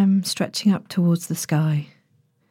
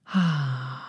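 long, low, soft relaxing sigh; 4 semitones lower, 4 dB quieter than usual. Shown as a demonstration. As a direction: let out a slow settling sigh. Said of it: "maybe a little sigh or two might feel good".

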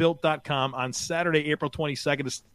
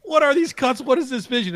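high, loud, amazed; excited disbelief; 10 semitones higher, 6 dB louder than usual. Real, not acted. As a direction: gush over the highlight tape. Raving about a player's cuts.

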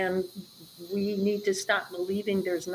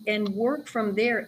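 normal talking voice

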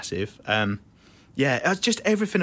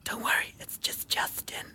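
mock whisper; 10 dB quieter than usual. Imitating [name]'s spoken break, whispered. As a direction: whisper it sweetly and cringily. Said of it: "he starts going".

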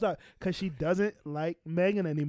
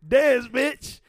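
mock shout, higher and louder; retold taunting shout; 6 semitones higher, 5 dB louder than usual. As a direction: shout it like a taunting gunman in a retelling. Acting out Tory firing at feet.

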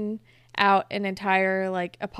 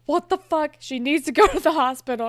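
louder, higher urgent outburst; retold outraged alarm; 5 semitones higher, 6 dB louder than usual. Friend swooping in to help the collapsed girl, urgent mock outburst. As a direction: imitate someone bursting in to take charge.

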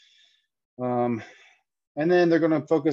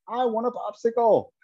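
much higher, whiny imitation of a crying kid; mock crying child; 11 semitones higher than usual. A parent retells the child begging for a popsicle, mock crying. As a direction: whimper it like a child who's been told no. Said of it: "the child just cries and is like".

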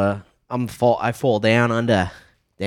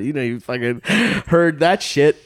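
usual speaking voice

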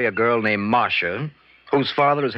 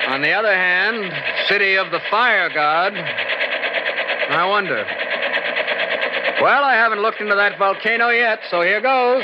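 shouting over engine; 7 semitones higher, slower than usual. Acted. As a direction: yell to be heard in the helicopter. Shouting over helicopter noise.

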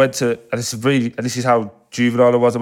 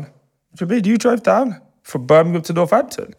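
high-pitched mock disbelief; incredulous questioning; 9 semitones higher than usual. Older brother questions fifteen-year-old who drove down, incredulous. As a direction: question it as if you can't believe your ears.

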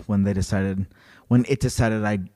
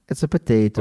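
mock Irish accent.

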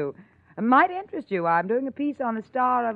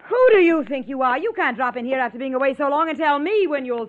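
raised, indignant voice; indignant, louder challenge to a pushy ex; angry outburst; 6 semitones higher, 4 dB louder than usual. Acted. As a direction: snap back at his arrogance. Nola furious at Paul.

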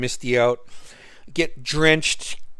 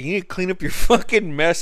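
higher, louder mock telling-off; half-laughing as you scold; imitated scolding; 6 semitones higher, 5 dB louder than usual. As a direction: roommate chewing him out over the kitchen mess. Friend scolding about mess.

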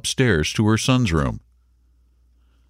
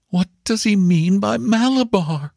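high, insistent question; distraught mother. A narrator's reading. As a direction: put it to her brother, pitched high. Mother asks her brother.

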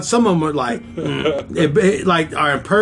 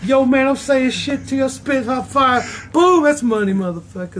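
put-on Italian accent Mario; 9 semitones higher than usual. Mario bragging about his rhymes, hammed-up Italian accent. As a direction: ham up Mario as an Italian rapper. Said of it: "you have the greatest Italian accent".

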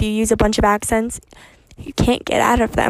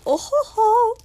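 high-pitched "oh ho ho"; squeaky, playful chortle; mock gleeful whoop; 10 semitones higher, 3 dB louder than usual. Mock glee over more helium.

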